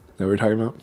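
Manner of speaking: ordinary talk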